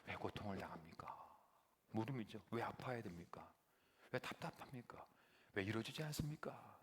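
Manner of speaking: soft, pleading prayer dropping to a whisper; hushed prayer. Acted. Moses praying to God in pain, hushed pleading.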